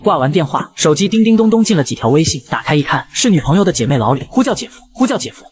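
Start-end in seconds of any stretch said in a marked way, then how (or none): 4.3-5.4 high, urgent repeated call; ping him like an alarm, pitched way up; high playful summons. A narrator's reading. Friend urgently messages brother-in-law.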